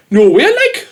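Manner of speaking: high, loud retold exclamation of surprise; mock shocked greeting. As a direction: burst out like you can't believe who's there.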